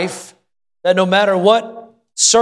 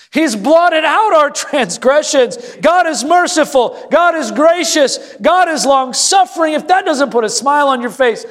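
high-pitched, fervent pulpit proclamation; impassioned preaching; 9 semitones higher than usual. Real, not acted. Pastor proclaiming God's mercy to the congregation, impassioned preaching. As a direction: drive each phrase home high and emphatic.

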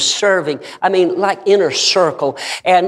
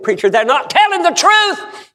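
high, urgent acted plea; impassioned retold cry; 13 semitones higher than usual. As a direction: cry it out as the distraught woman. Retold woman's anguished plea to preacher.

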